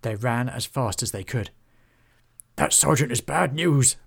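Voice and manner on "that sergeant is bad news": higher, louder puffed-out complaint. A narrator's reading. Beef flees the bailiffs with friends, puffed. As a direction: puff it out after running. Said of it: "puffed Beef".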